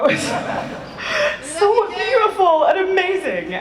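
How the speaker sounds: high, emotional laugh through the words; moved laugh after the trailer; teary laughter. Real, not acted. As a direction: laugh while fighting back tears. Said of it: "I'm trying not to cry after watching that trailer".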